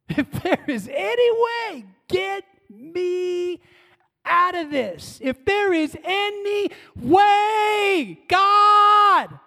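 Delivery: rising to a high, loud cry; anguished plea. Acted. Preacher voicing Jesus crying out to God in agony. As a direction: build from pleading to a desperate cry.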